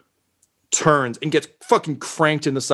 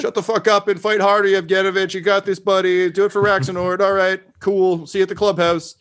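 gruff buddy voice; 8 semitones higher than usual. Acted. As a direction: cheer on a buddy. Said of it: "Manny's just like".

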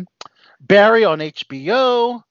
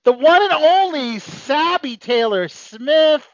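hype announcer intro; 9 semitones higher, slower than usual. Acted. Hyped host introducing guest.

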